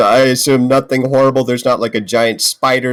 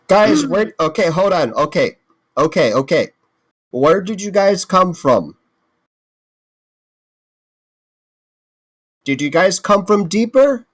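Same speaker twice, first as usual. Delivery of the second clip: higher-pitched, restrained questioning; irritated, keeping composure; 8 semitones higher than usual. Acted. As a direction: hold your temper while you ask. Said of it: "see if I lose my composure".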